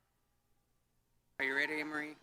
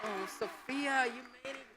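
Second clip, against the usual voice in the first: delighted greeting; 8 semitones higher than usual. Real, not acted. Greeting an arriving student.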